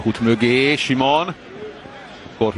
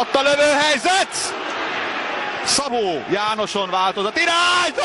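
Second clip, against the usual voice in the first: high shouted shot call; excited call; 13 semitones higher than usual. Real, not acted. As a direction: yell as the shot goes. Shot on goal.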